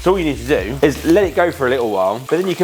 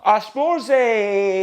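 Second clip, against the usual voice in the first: put-on high Scottish accent; Billy Connolly impression; 8 semitones higher, much slower than usual. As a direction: Glaswegian comic voice. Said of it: "It's Billy Connolly".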